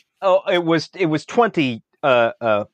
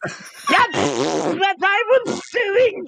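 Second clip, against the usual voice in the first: indignant shout; 9 semitones higher, slower than usual. Real, not acted. Indignant protest at table.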